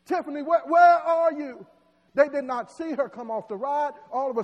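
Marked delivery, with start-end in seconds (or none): 0.0-1.7 crying out. Acted. Parents cry out for missing Tiffany, loud desperate calling. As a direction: call out for a lost child. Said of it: "they begin to cry out".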